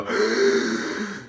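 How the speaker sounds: big exaggerated inhale; deep gasp. Imitating podcasters running out of air mid-thought, deep gasp. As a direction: gulp in air as if out of breath. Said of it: "then they have to go".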